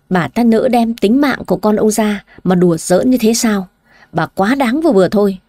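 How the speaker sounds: ordinary delivery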